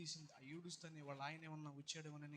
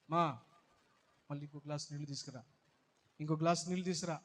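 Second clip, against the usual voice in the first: calling loudly for more water; urgent call; 13 dB louder than usual. Acted. Stranger asking the mother for water, urgent loud call. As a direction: call out quickly, insistent.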